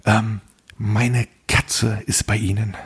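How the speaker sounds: slow, measured imitation of an odd woman; uncanny neighbour. Barefoot neighbour at the door about her cat, slow uncanny imitation. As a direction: draw it out like a creepy neighbour. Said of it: "immer so ein bisschen unheimlich".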